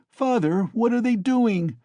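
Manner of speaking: put-on a boy begging his father; horrified child. A narrator's reading.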